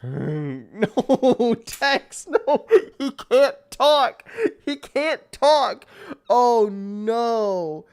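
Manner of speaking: high, loud, slow wailing "He can't talk"; anguished wail. Real, not acted. Gamer wailing at his character Tex, loud anguish.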